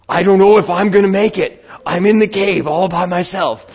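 hammed-up TV survivor in an unplaceable accent; botched mock accent. Preacher mocks a survival show host in the cave, botched dramatic accent. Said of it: "I don't even know what accent that is".

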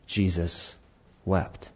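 very soft, slow, reverent; hushed solemn reading. Acted. Pastor reading Jesus wept to the church, hushed and reverent. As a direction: let the two words fall quietly.

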